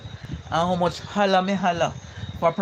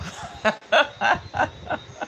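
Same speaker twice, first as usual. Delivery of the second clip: real laugh; 8 semitones higher than usual. Real, not acted. Laughing at a viewer's chat message, amused laugh. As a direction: burst of high laughter.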